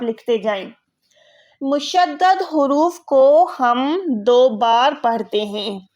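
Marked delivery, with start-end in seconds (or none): 1.6-5.9 slow dictation. Shown as a demonstration. Teacher dictating the rule for students to copy, slowly. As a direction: rule read out slowly, word by word. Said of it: "आपने सबसे पहले क्या लिखना है".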